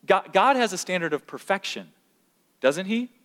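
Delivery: normal talking voice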